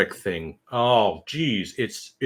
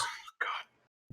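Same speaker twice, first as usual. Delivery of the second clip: whisper; 18 dB quieter than usual. Real, not acted. Disgusted aside.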